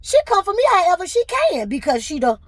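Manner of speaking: high, loud angry retort; indignant retort. Retold or imitated. Retelling her reply to HR on the call, angry and high. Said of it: "I said".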